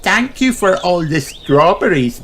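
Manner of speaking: high cheerful piglet voice; Peppa voice. A narrator's reading. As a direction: thank her brightly like a little girl. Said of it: "says Peppa".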